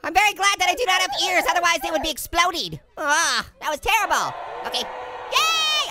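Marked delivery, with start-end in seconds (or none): 4.8-5.9 high-pitched mock celebration; playful cheer. Cheering on the guy they support, playful.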